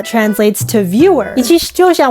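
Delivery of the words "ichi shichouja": speaking with a retainer in; words slurred by a retainer; wearing a retainer. Real, not acted. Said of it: "I can't say that with my retainer".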